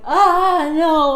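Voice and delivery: loud, high, drawn-out wail; storyteller's mock howl of dismay; anguished mock scream. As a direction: wail in horror, hammed-up. Monster sees mate torn apart.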